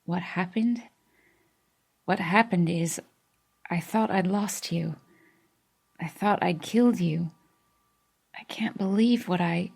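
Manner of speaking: soft, choked-up voice; strained, emotional. Acted. Denny tells Kiera he thought he'd lost her, strained. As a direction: hold back tears. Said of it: "His accent sounded strained to me".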